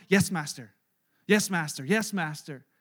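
low, lightly voiced obedient reply; a hint of a servant bowing to orders; submissive servant. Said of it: "their response to commands is always".

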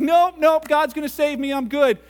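cheerful refusal. Acted. Hanging man refuses help.

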